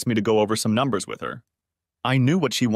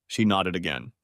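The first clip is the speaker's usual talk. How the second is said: plain reading voice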